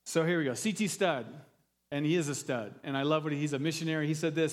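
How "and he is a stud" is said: ordinary talk, nothing marked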